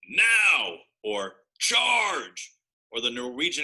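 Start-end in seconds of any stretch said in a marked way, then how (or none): 0.0-0.9 loud, high battle shout; dinosaur yell. A narrator's reading. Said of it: "a trio of dinosaurs yelling". 1.6-2.5 very high, loud charging cry; dinosaur yell. A narrator's reading. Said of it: "a trio of dinosaurs yelling".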